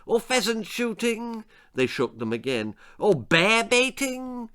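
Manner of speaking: lady demanding blood sports; snobbish posh lady. A narrator's reading. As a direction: sneer down at common folk. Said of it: "shrieked Lady Davinia".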